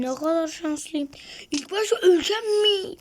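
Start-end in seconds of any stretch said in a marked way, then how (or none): 1.5-3.0 high, loud, strained yelp; a loud frightened wail; panicked cry. Real, not acted. Panicked recalling near death.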